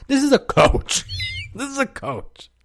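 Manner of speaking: high-pitched, through laughter; words breaking up into laughter; laughing disbelief. Real, not acted. Comic reacting to his own coach story, laughing in disbelief.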